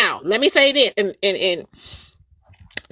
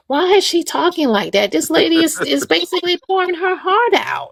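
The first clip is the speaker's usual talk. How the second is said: much higher, indignant mock protest; mock outraged listener; 9 semitones higher than usual. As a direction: squeal it like a scandalised onlooker.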